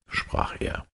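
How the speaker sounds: usual speaking voice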